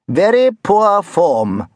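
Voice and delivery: high, very slow plummy schoolmaster; old-school form master's verdict; stuffy form master. A narrator's reading. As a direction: drawl it out in disapproval. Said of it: "the form master had concluded".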